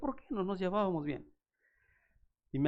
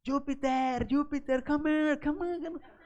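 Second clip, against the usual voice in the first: calling the dog; 12 semitones higher than usual. Retold or imitated. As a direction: shout for the dog to come back. Calling runaway dog loudly. (llamando a gritos al perro)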